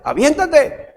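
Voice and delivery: coaxing call. Retold or imitated. Father urging kids off car roof.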